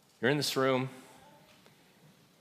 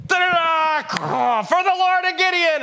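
much higher, louder, slow drawn-out war shout; battle cry; 11 dB louder, slower than usual. Retold or imitated. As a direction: roar it like charging into battle. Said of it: "Gideon's like".

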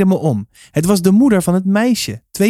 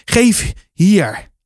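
panting; 5 semitones higher than usual. A narrator's reading. Winded guard demanding the child, panting. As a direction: puff out the order between breaths. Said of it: "hijgde hij".

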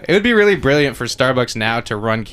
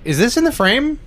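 higher-pitched questioning, rising at the end; acted uncertain query; 12 semitones higher than usual. Actor asking the crew on set, uncertain query. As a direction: ask it high, checking with the crew.

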